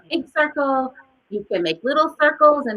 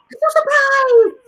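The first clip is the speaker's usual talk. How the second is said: surprised demo; 13 semitones higher, 7 dB louder than usual. Shown as a demonstration. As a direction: gasp and high loud exclamation. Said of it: "We're gonna do surprise".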